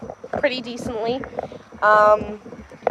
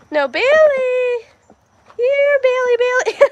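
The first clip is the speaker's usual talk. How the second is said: calling the dog; 10 semitones higher, slower than usual. Real, not acted. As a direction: call her across the yard, slowly.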